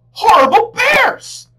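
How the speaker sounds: very high, slow, loud frustrated cry; angry wail. A narrator's reading. Upset girl wailing while trying to read.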